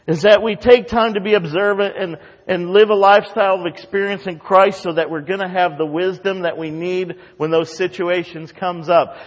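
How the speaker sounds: full-force pulpit preaching. Acted. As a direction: preach it with rising fervour.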